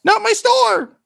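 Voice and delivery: very high, loud little voice, distressed; tiny shopkeeper. Acted. Tiny shopkeeper crying out at the crash, very high and loud. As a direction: squeak out a loud cry of dismay. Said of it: "a voice just goes".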